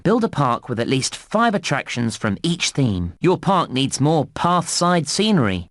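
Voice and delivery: normal talking voice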